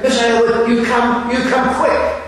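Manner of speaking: mock urgent messenger calling at the door; urgent summons. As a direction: hurry her out the door, a touch breathless. Messenger urges her to come.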